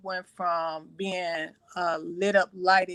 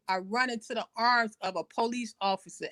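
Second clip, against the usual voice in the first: usual speaking voice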